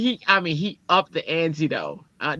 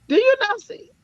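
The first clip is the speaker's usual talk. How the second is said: pitched way up in disbelief; incredulous; 3 dB louder than usual. Retold or imitated. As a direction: act stunned that he'd even ask. Incredulous at supervisor's order.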